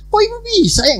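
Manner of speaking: very high-pitched mock astonishment; incredulous unspoken question. Pastor's unspoken question to the crying woman, incredulous. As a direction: leap up high in disbelief. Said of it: "Tapi saya nggak bilang gitu".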